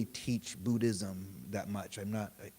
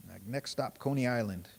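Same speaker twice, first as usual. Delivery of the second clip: usual speaking voice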